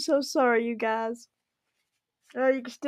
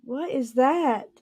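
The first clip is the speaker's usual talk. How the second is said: usual speaking voice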